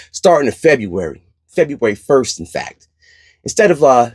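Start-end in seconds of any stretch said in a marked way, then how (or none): none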